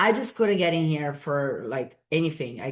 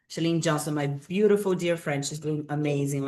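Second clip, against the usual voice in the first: ordinary talk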